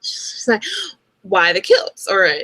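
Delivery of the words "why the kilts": imitating Diana. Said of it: "Diana has this weird voice".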